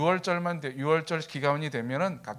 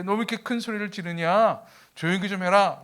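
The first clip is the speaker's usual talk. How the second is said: mock rebuke, a bit louder; imitated scolding; 4 semitones higher, 6 dB louder than usual. Crowd hushing the blind beggar, imitated scolding. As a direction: tell someone off for shouting.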